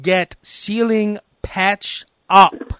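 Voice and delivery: very slow, stretched words; slow condescending English. Retold or imitated. Customer talking down to a Spanish speaker, very slow. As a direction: drag every word out like he's slow. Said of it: "talking to him like an asshole".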